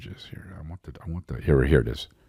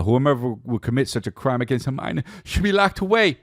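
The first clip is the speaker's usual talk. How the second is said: concert hype; 8 semitones higher than usual. Retold or imitated. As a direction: work the crowd like on stage. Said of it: "Like he's at a concert".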